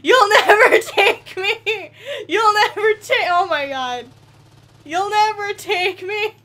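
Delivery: high, loud, laughing defiant cry; hammed-up last stand, laughing through it; mock defiance. Defying the oncoming train.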